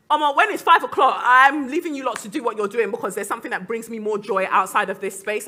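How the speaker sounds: loud, lifted, lightly acted send-off; acted clock-off declaration. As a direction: announce your exit to imagined colleagues. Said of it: "when you're like".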